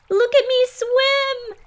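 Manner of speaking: very high, slow proud squeak; squeaky Roo. A narrator's reading. Roo showing off going over the waterfall, squeaky.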